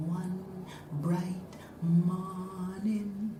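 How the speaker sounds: soft, very slow half-sung incantation; quiet, slow chant; hushed magic words. A narrator's reading. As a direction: draw each word out gently like a spell. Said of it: "knelt down and whispered".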